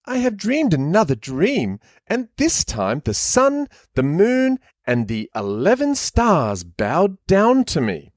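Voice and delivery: put-on a whiny rich kid calling his daddy; posh kid voice. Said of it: "what was the voice again?".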